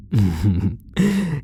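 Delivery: a short pleased chuckle; a quick satisfied laugh. Acted. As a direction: laugh lightly after the kiss. Laugh after stealing a kiss.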